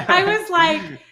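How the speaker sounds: amused lead-in; laughing. Real, not acted. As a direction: keep laughing into the words. Still laughing.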